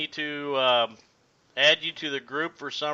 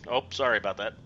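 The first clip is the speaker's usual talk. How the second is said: usual speaking voice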